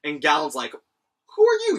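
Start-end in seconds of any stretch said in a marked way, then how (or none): 1.4-1.8 very high, loud startled question; startled shout. Retold or imitated. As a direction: jump out of your skin asking it. Galen startled by Escanor.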